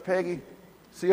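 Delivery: usual speaking voice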